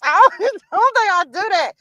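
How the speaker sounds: high words through laughter; laughing. Real, not acted. Amused by the remix, high and laughing.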